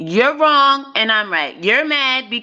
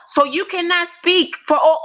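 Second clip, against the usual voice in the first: ordinary talk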